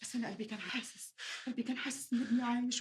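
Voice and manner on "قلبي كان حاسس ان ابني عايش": usual speaking voice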